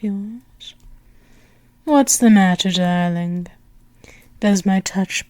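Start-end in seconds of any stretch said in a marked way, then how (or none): none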